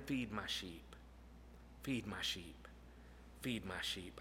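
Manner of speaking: low and soft, gentle as a shepherd; tender Jesus voice. Acted. Jesus speaking tenderly to Peter, low and soft. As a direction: speak it softly, almost under the breath.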